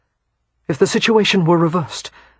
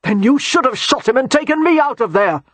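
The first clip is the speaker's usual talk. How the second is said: bitter outburst; 8 semitones higher, 3 dB louder than usual. Acted. Bennett shouting at Christopher.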